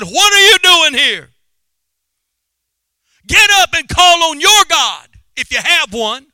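high, loud shouted demand; preacher acting an irate ship's captain; angry captain. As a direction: confront the stowaway at full voice. Captain confronts Jonah.

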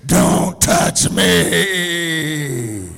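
very loud, drawn-out demon roar; hammed-up possessed scream, stretch every word long; demonic scream. Wife in labor to husband leaning to kiss her, demonic scream. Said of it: "in this demonic scream says".

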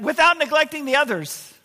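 "Without" pushed high and hard; stress on without. Retold or imitated. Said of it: "want to emphasize that".

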